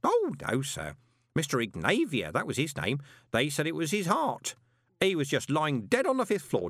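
high-pitched gossipy underling; lively, high character voice; chatty old postman Grote. Acted. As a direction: relay grim gossip with relish, pitched well up.

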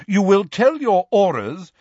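stern, forceful instruction; commanding. A narrator's reading. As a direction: issue the order with firm authority. Dumbledore continues his orders.

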